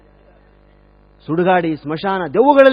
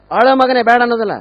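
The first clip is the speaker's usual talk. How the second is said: scolding shout; 5 semitones higher, 6 dB louder than usual. Acted. Elder scolding crying man.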